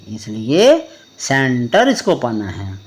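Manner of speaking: ordinary talk, nothing marked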